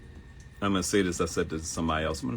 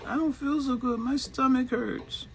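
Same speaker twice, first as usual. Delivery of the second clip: put-on whiny little kid. Child voice complaining of sickness.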